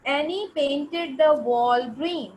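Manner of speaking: slow, careful reading aloud; slow dictation. Shown as a demonstration. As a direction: read it out slowly for the class. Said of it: "teacher dictating a sentence".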